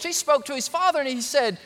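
usual speaking voice

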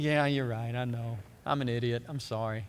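low, deflated admission; acted meek confession, voice dropped low; sheepish apology. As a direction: give in, chastened. Rebuked Peter gives in and apologizes.

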